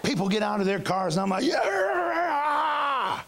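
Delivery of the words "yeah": defiant shout. Retold or imitated. Retelling shouting at a driver stepping out of his car, high mock challenge. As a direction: bellow a dare at the other driver. Confronting driver who gets out.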